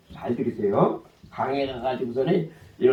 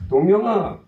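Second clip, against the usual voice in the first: a louder call across the yard; 7 semitones higher, 8 dB louder than usual. Retold or imitated. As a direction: call his name to summon him. Brother calling him. (형이 동생을 부르는 외침)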